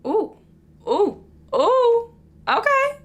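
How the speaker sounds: thrilled by how smooth her own skin looks; excited ooh. Real, not acted.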